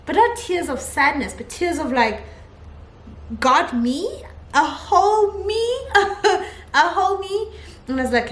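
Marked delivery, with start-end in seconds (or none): 3.4-7.5 high, drawn-out amazed question; overwhelmed, incredulous, stretching the words out; joyful disbelief. Retold or imitated. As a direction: laugh through your amazement. Retelling tearful joyful disbelief.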